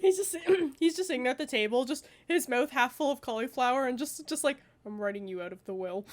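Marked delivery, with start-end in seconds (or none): 4.9-6.1 low, soft mock threat; deadpan betrayed dad. Imagined dad to the pranksters over cauliflower, low quiet deadpan. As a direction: drop it low and quiet, like a wounded father.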